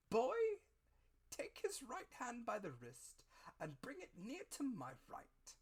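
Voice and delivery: put-on slow, then high and sharp on boy; high, slow beggar's orders; blind beggar. A narrator's reading. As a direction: calmly dictate each step. Blind beggar directs the handover.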